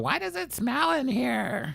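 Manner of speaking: put-on high, slow child's question; squeaky little kid asking. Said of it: "this kid was all".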